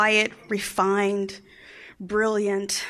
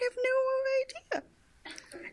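bewildered; 8 dB quieter than usual. Retold or imitated. Child's bewildered look, voiced tiny.

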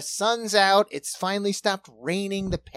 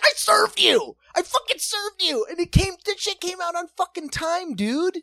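much higher, louder outraged yell; exasperated shout; 6 dB louder than usual. Retold or imitated. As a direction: scream it in disbelief at the customer. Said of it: "I was like".

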